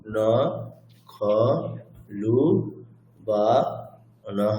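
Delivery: pausing after each syllable; halting, one isolated syllable at a time. Shown as a demonstration. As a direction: count out each syllable separately. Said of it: "থেমে থেমে".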